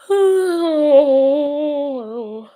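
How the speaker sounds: big, loud drawn-out yawn; real yawn. Real, not acted. Streamer to viewers mid-livestream, real yawn. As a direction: let out a long, high yawn that falls away. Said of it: "I just yawned".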